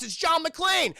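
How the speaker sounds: high, loud burst of excitement; excited reveal. Real, not acted. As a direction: shout the big reveal.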